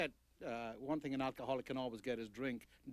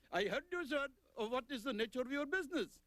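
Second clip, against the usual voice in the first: much higher put-on voice of a clerk answering the phone; Indian phone clerk; 11 semitones higher than usual. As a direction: play the trading company clerk picking up, pitched high.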